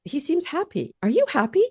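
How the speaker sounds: high, incredulous questioning; baffled. Retold or imitated. Wife quoting herself to her calm husband, high and baffled. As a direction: ask it in a high, disbelieving voice. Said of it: "I'm like".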